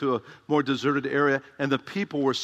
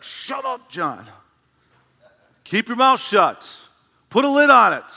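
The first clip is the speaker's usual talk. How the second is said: high, exasperated mock yell at himself; mock self-scolding; 7 semitones higher, 6 dB louder than usual. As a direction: tell yourself off, building to a loud shout.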